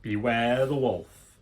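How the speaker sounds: usual speaking voice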